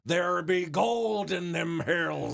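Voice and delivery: slow hammy pirate growl. Pretend. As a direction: ham it up like an old sea pirate. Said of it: "the pirate gimmick".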